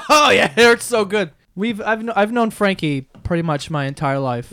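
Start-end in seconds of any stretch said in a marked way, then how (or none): 0.0-1.3 laughing. Real, not acted. After drinking the shot.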